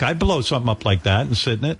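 normal talking voice

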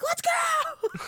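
high, strained hype yell; mock yell, squeezed up high; Saleh's yell. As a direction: imitate a coach yelling into the phone. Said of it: "he just yelled into it".

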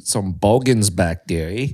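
slow mock-Aussie drawl; amateur Australian impression; Australian accent. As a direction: stretch it out in put-on Australian. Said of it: "try to do an Australian accent".

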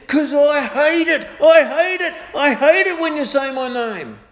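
high, loud furious yell; imitated angry roar. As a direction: roar it chest to chest. Angry coworker confronting him.

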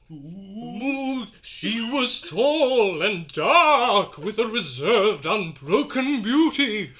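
slow, drawn-out ghostly wail. Shown as a demonstration. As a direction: moan it out like a ghost haunting a house. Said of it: "it has to be ghost voice".

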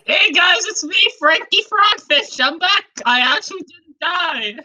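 high-pitched cartoon frog voice; Frankie Frogfish. Fish back from the dead greets the gang, high put-on voice. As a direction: pitch it up for a returning fish character. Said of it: "That's his classic Frankie voice".

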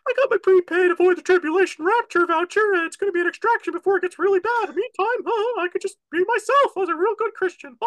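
very high, loud put-on voice; squeaky, hammed-up caricature of a complacent believer. Host mimicking Christians banking on the rapture, mocking high voice. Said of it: "A lot of Christians are just going".

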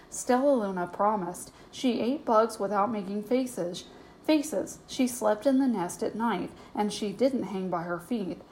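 ordinary delivery

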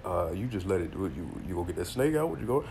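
soft mock nagging; mocking the critics. Imitating people who nag him about the snake, soft. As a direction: mumble their question back dismissively. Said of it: "motherfuckers is like".

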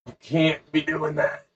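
high, slow mock scolding; drawn-out goody-goody objection; Dave. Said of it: "Dave would be like".

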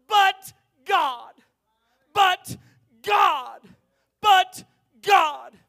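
shouted high and loud, far off voice; preaching shout. Acted. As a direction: pound the two words to the room. Preaching shout on two words.

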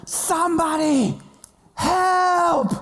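high, drawn-out cry for help; yelling for help. Retold or imitated. Trapped boy calling mom out of the outhouse, yelling. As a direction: re-enact a panicked holler for mom. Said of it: "I started yelling out of the outhouse".

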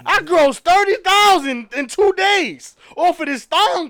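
loud, high mock-indignant female boast; boastful woman. Woman with the bag tells off her man, boastful. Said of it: "a female with the bag would be like".

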